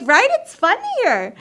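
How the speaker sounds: high, drawn-out gushing; overdone chummy agreement; eager joining-in. Retold or imitated. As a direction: play the oblivious newcomer, high and slow. Naive newcomer joining girls' laughter.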